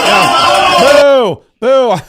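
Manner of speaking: loud, high jeering boos; mock booing. Host joins clip crowd booing Mamdani, loud mock jeers. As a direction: boo along with the crowd for fun.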